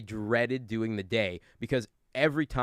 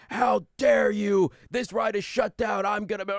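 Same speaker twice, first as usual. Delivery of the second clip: imitating irate guests; 8 semitones higher than usual. Grown man yelling at a young ride worker, mock outraged shout. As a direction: mimic a furious guest yelling in your face. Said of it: "come up and yell at me".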